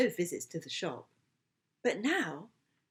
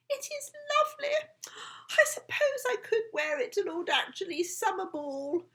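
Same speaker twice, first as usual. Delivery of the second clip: a lightly voiced, higher dreamy lady; wondering aloud; 10 semitones higher than usual. Lady Wallopy muses aloud about the dress. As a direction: muse to yourself over the dress. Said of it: "she wondered aloud".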